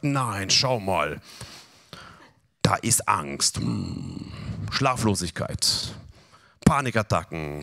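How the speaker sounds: low, slow, menacing devil's whisper. Acted. Preacher voices the devil tempting the believer with fear, low and sly. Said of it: "dann kommt der Teufel wieder und sagt".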